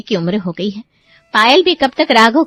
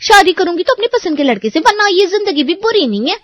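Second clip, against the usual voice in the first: defiant retort; 7 semitones higher than usual. Acted. Sister defies brother about marriage.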